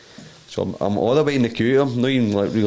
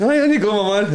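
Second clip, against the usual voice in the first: high, louder, cheery mock doorman; doorman waving him through; 13 semitones higher, 5 dB louder than usual. Searcher waves the disguised punter through, high-pitched mock voice. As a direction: act out a searcher sending someone on, pitched way up.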